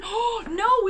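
ordinary talk, nothing marked

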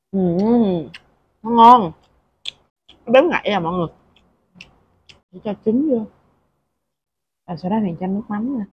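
talking while chewing; speaking around food; mouth full. Real, not acted. Seen 0: Egg enters mouth at -1; cheeks full, chewing while speaking.

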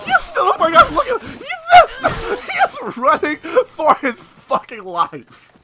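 cackling at a fleeing man; gleeful disbelief. Real, not acted. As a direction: squeal it in disbelief. Watches the fleeing villager.

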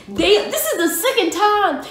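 high, loud, slowed damn; pumped about the artist; excited outburst. Real, not acted. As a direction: burst out thrilled.

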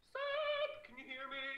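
very high, loud holler; calling out. Real, not acted. Streamer calling Seb through his headphones, high holler. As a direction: holler to get someone's attention.

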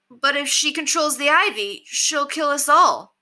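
high-pitched alarmed call; calling from crowd. A narrator's reading. Bandit warns the leader from the crowd, calling out. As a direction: call out your objection, slowing on the end. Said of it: "Someone called from the crowd".

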